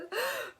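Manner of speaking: high, sharp hammed-up sob-gasp; dramatic crying gasp. Vlogger acting out teen heartbreak, high mock sob gasp. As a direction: gasp like the world is ending.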